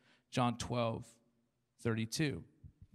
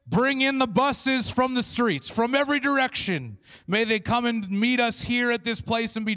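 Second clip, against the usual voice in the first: mock Pentecostal prayer; 5 semitones higher, 8 dB louder than usual. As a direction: pray like a fired-up Pentecostal. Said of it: "we say".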